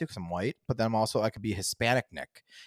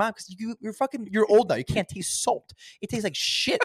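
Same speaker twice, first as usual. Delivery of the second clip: exasperated ranting; 9 semitones higher than usual. Retold or imitated. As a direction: vent at mom, voice up high. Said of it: "I'm like".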